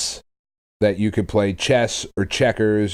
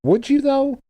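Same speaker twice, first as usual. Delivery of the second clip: skeptical pitch leap upward; higher incredulous question. Real, not acted. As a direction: doubt they'd really play it. Skeptical about Federation Force.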